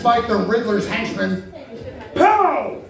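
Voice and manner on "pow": comic punch sound. Pretend.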